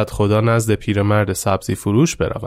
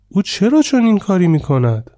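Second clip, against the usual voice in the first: higher, puzzled disbelief; astonished question; 8 semitones higher than usual. A narrator's reading. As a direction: ask in wide-eyed surprise. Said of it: "با حیرت گفت". (با حیرت و صدای بالاتر)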